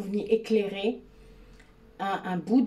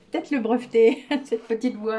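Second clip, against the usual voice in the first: laughing; 4 semitones higher, 5 dB louder than usual. Real, not acted. Laughing at her own impression.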